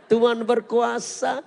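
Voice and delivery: loud, slow jubilant shout of praise; cheering congregation. Retold or imitated. The congregation cheers loudly in jubilant praise. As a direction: cheer it out like a crowd. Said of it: "Umatnya bersorak".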